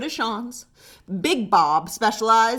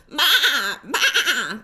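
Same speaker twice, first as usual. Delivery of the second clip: excited bleat; 3 semitones higher, 3 dB louder than usual. Acted. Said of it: "they excitedly exclaimed".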